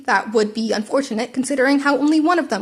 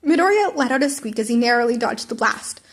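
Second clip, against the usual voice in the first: ordinary delivery, nothing marked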